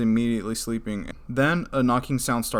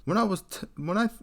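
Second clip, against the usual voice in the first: a higher, half-hearted stab at a British accent, abandoned mid-line; botched British accent; 8 semitones higher than usual. Shown as a demonstration. Narrator reading a British poster's story, failed British accent. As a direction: try the British accent and lose it at once. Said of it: "I can't even do a British accent".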